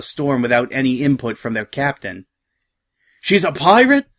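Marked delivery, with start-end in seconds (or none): 3.2-4.1 high, loud yelp of disbelief; stunned soldier crying out; yelling. A narrator's reading. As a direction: yell it as a startled question. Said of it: "a soldier yelled".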